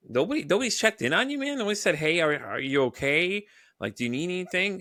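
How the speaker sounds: can't believe no one asked; disbelief. Retold or imitated. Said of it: "It's like".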